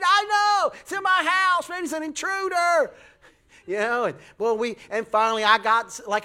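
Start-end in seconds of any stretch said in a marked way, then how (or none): 0.0-2.9 high, loud indignant protest; mock outrage. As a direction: protest like your house was invaded. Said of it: "I said".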